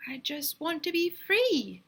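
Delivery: high, slow chirpy little voice; boy pleads with the men, high chirping plea; chirped. A narrator's reading. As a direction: chirp it like a small bird. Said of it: "chirped Myron".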